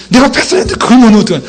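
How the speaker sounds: fast, high-pitched acted complaint; mock blaming. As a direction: rattle off blame at a teammate. Blames teammate after own pass. (빠르고 높게 남 탓하는 흉내)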